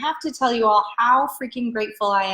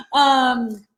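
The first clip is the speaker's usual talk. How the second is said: ordinary talk, nothing marked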